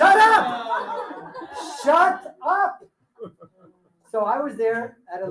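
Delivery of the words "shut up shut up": high, loud laughing "shut up!"; mock shout. Coach cuts off his own rambling pitch with a mock shout. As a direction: yell at yourself mid-sentence, half laughing.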